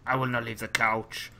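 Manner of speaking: whispered put-on American accent; breathy mock impression of the couch-bound friend; mock Colin accent. Replaying his friend's voice in a whispered put-on accent. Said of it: "That's my Colin accent".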